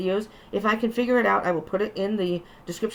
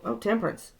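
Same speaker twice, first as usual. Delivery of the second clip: usual speaking voice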